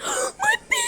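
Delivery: gasp, then a very high squeal; horrified. Pretend. Mother sees the baby being hit, horrified squeal. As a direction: pretend panic in a tiny shriek.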